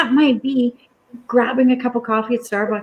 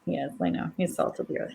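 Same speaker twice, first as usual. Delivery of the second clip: hushed, breathy near-whisper, much softer; whispered aside; 5 semitones lower, 13 dB quieter than usual. Real, not acted. Host whispering her agreement that her friend's husband is wonderful. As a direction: let it drop to a private whisper. Soft aside about Bernie.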